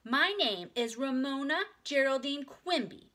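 put-on little girl. A narrator's reading. Ramona tells the lady her full name, in a high child voice. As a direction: announce it like a proud four-year-old. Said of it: "answered Ramona".

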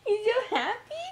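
high giggly coo. Real, not acted. Cooing at baby, laughing.

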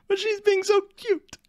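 high-pitched, adoring squeal; gushing over cuteness. Real, not acted. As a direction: coo in a very high voice. Gushing over a cute character.

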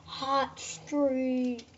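slow, drawn-out game announcer. Retold or imitated. Kid copying the game's announcer, slow and grand. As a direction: stretch the name like the game does. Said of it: "That's how they say it in the game".

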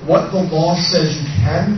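normal talking voice

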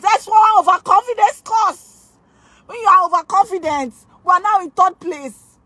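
high, fast, worked-up scolding; agitated scolding. Real, not acted. Streamer scolding viewers for not voting, high agitated rant. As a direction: scold the chat in a frantic rush. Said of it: "Okay, calm down".

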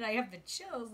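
ordinary delivery

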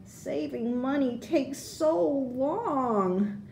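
put-on high, slow, soft childish whine; impatient sigh. A narrator's reading. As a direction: let the waiting drag in your voice. Child impatient waiting to buy.

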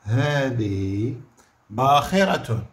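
هذه stretched out slowly; drawn-out هذه for dictation. Shown as a demonstration. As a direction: spell it out slowly for pupils. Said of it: "شنو نقولوا؟".

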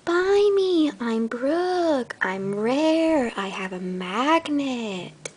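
a little toy begging from its box; pet toy's sales pitch. Pretend.

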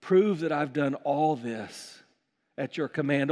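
low, measured plea to God; solemn prayer. Acted. Elijah at the altar praying for proof, low solemn prayer. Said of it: "he prayed".

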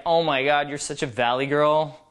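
low mock exasperation; playful mock dismay, trailing down low; playful teasing. Shown as a demonstration. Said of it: "It should be like".

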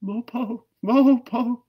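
very high croaking call, fading away; croaked bird call. A narrator's reading. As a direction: croak it like a night bird flying off. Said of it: "croaked the nightjar".

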